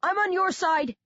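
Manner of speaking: high frightened plea; panicked cry. A narrator's reading. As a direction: plead in panic. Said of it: "Coley cries".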